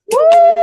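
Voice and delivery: high, loud cheering whoop; excited woo. Real, not acted. Host cheering the club streaks, loud high whoop. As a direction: whoop it up for the clubs.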